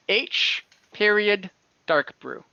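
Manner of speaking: ordinary talk, nothing marked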